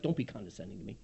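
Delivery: mock offended. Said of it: "Not condescending like".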